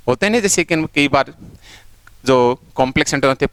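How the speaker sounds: normal talking voice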